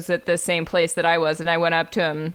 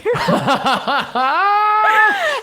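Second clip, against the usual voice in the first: shrieking laugh, much higher and louder; real laughing fit; loud high burst of laughter; 14 semitones higher, 5 dB louder than usual. Real, not acted. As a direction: cackle loudly at the memory.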